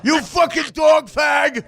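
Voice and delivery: drawn-out high shouted insult; imitated Levy yell. Host imitating Levy insulting the dog, drawn-out yell. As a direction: scream it slowly at the dog. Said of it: "and then Levy's like".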